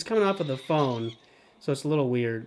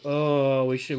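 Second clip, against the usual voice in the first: usual speaking voice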